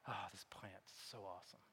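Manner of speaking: very soft, low sigh of relief; acted hushed contentment; contented sigh. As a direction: murmur it low, blissfully relieved. Jonah voiced as blissful under plant.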